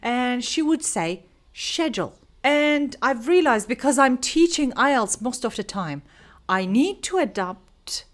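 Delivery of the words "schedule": "schedule" with a SH start, British style. Retold or imitated. Quoting teacher trainer's British accent, "schedule" starting SH. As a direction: say "schedule" as SHED-jool. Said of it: "very strong British accent".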